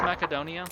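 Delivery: "Macedonia" with a K for the c; hard-c pronunciation. Shown as a demonstration. Streamer asking a viewer how it's pronounced, hard-c pronunciation. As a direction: ask it as mack-uh-DOH-nee-uh for "Macedonia". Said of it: "is it pronounced".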